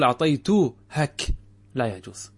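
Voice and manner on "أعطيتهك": slow wrong-form demo. Shown as a demonstration. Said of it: "لا يجوز أن تقول".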